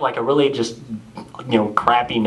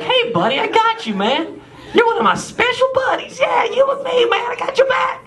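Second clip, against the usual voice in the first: very high, shouted buddy voice, laughing; a cheery surfer-dude God; God as a bro. Retold or imitated. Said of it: "I don't know why God talks like that".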